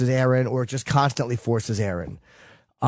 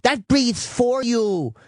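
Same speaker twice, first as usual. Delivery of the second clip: higher, slow dismissive mock of the tech; 8 semitones higher, slower than usual. Man mimicking the female sleep tech, higher mocking voice. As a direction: ham up her know-it-all tone, higher. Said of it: "the fucking female tech comes in and goes".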